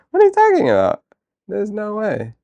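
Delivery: high, loud disbelieving squeal; outraged, amused protest; shocked disbelief. Real, not acted. Reacts to a chat joke.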